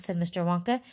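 ordinary delivery, nothing marked

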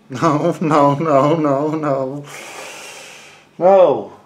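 loud exasperated no; frustrated shout. Real, not acted. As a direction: yell it at yourself.

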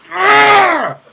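very high, very loud scream; frustrated scream. Real, not acted. Gamer loses it after a mistake, screaming. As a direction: scream out your rage at the game.

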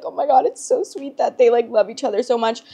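high, gushing mock delight; gushing and teary. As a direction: swoon over the sweet couple. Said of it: "I'll be like".

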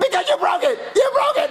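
put-on high-pitched shrieking kid; yelling. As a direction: scream it like a furious child. Child screams at father.

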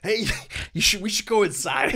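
high, loud laughing warning to go inside; laughing plea. Retold or imitated. On the rocking boat, urging the man inside, laughing.

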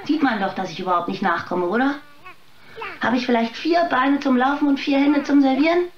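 fed up with the guests, let it climb; overworked waitress snapping back at demanding guests, exasperated; exasperated outburst. Acted.

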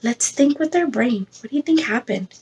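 usual speaking voice

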